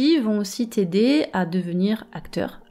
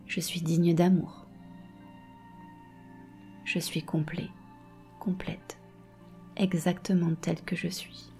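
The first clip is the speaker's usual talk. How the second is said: right in your ear. Acted. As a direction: breathe calm into every phrase. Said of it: "Je vais te guider dans une méditation".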